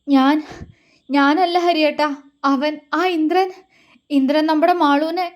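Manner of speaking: broken phrases, lightly out of breath; panting. A narrator's reading. Gauri telling Hari that Indran took Malu, panting. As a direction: gasp the words out between pauses. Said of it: "അത്രയും കിതപ്പോടെ അവൾ പറഞ്ഞപ്പോഴേക്കും".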